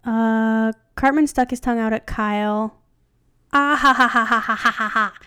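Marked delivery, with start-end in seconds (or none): 3.5-5.1 loud "ah", then evenly spaced ha-ha-has that ease off; hammed-up spelt-out laugh; mock taunting laugh. A narrator's reading. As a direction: read out a mocking string of laughs. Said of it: "Cartman said in a sing-song voice".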